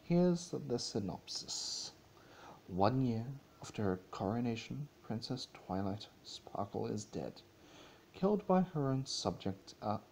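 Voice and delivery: normal talking voice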